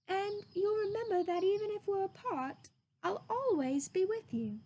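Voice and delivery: put-on a boy promising to stay close; tender boy voice. Christopher Robin's farewell.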